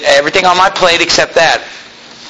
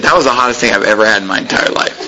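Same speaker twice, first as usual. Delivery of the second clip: lower, fast, a bit amazed; lightly voiced boy; 7 semitones lower, faster than usual. Teenager telling the preacher about the pepper, lower and fast. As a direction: report the burn in awe.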